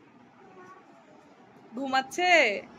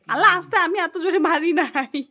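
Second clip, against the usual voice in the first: loud, higher yelp of protest; startled ouch; 6 semitones higher, 8 dB louder than usual. Real, not acted. Mother hit by toddler, startled loud protest. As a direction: react sharply to the hit. Toddler hits her, she reacts.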